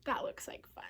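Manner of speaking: ordinary delivery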